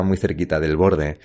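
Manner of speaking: plain reading voice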